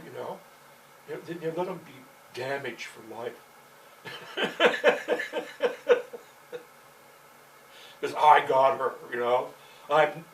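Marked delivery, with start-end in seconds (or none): 3.4-7.3 loud high cackle; high laugh. Real, not acted. Laughing hard at his own boast to the listener.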